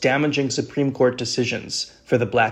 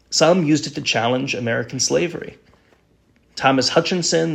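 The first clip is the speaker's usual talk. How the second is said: ordinary talk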